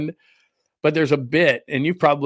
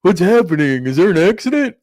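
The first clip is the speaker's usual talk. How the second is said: mock rubberneckers; 4 semitones higher, 7 dB louder than usual. People driving by in traffic, mock gawking. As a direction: imitate nosy gawkers, louder and rising higher. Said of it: "it's just people going".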